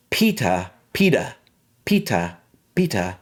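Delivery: British "Peter" ending in "ah"; British accent. Shown as a demonstration. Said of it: "in the British version".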